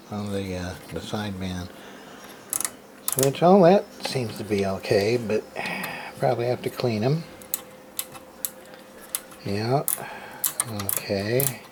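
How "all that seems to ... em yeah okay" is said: ordinary talk, nothing marked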